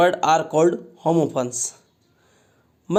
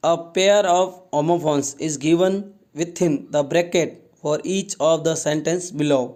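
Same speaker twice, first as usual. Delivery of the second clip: slow instruction reading; much slower than usual. Shown as a demonstration. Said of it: "teacher reads instruction slowly".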